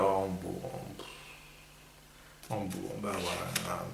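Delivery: low, soft, deflated resignation; real disappointment. Real, not acted. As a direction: sink into it after the wrong answer. Learns his answer was wrong.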